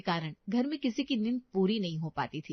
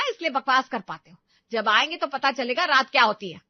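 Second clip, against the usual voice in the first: louder sharp scolding; angry scolding; 3 semitones higher, 6 dB louder than usual. Acted. Mother angrily scolding her son, raised voice.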